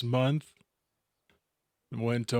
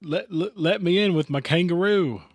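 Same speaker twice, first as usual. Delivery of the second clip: bad mock Australian accent, loud and higher; terrible Australian accent; 5 semitones higher, 4 dB louder than usual. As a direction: ham up a cheap Aussie accent on purpose. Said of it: "that was a terrible accent I just did".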